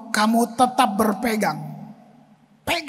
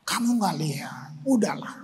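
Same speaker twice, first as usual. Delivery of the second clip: lower, hushed mocking voice of the tempter; devil's taunt; 4 semitones lower, 6 dB quieter than usual. The devil taunting the old pastor, low hushed sneer. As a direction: drip doubt into his ear, low and soft. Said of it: "Si kunyuk memang begitu".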